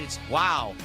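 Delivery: ordinary delivery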